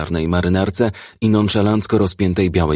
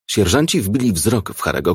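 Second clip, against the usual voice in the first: usual speaking voice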